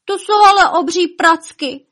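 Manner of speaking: high, loud cry of alarm; exclaiming. A narrator's reading. Elf finding huge footprints, crying out. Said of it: "vykřikl".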